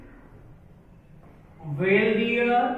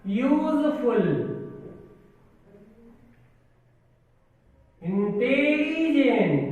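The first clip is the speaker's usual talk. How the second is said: slow dictation; 3 dB quieter, much slower than usual. Shown as a demonstration. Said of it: "यहाँ लिख दिया".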